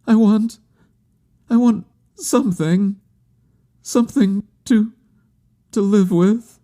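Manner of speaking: very high, halting plea; heartbroken pleading. A narrator's reading. Woman wanting something to live with, high and heartbroken. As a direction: falter between phrases in a high, grieving voice. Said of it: "murmured in a heartbroken tone".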